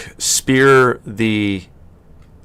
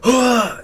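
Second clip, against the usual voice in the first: high-pitched startled grunt; tripped grunt. Acted. Game master as an orc being tripped, startled grunt. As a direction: cry out as you are tripped. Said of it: "you hear him just, like, going".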